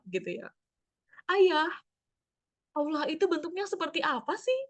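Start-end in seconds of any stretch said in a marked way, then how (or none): 1.3-4.6 higher, lightly voiced child asking father; a hint of a curious child. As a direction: wonder aloud like a small kid. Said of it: "pertanyaan yang susah dijawab".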